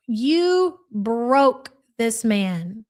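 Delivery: each word stretched out very slowly; slow dramatic reveal. Real, not acted. Streamer revealing the photo to Chantel, slow and drawn out. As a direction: let it land slowly, like showing the evidence.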